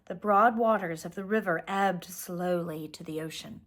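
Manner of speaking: ordinary delivery, nothing marked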